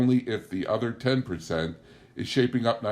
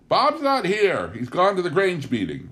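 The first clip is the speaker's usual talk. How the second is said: rude shout; 10 semitones higher, 6 dB louder than usual. A narrator's reading. Young wife shouts rudely back at drunken callers. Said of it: "so I shouted back just as rudely".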